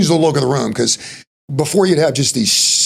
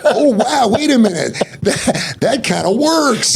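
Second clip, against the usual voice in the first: excited realization; 9 semitones higher, slower than usual. Retold or imitated. Retold realization, with laughs.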